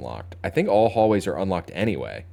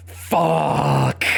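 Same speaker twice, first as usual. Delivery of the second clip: loud curse; 7 semitones higher, 4 dB louder than usual. Real, not acted. Dismayed at bad draw.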